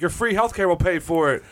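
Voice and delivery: high, cheeky taunt; a high, cheeky taunt aimed at the Toronto fan; mock taunt. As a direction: needle the Canadian fan in a high voice. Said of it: "And I was like".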